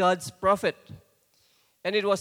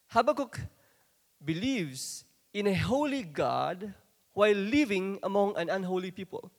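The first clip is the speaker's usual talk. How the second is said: ordinary talk, nothing marked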